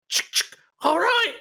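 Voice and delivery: high excited soldier voice; NPC voice. Acted. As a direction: bark it out high and eager. GM voices NPC Demetra cocking gun.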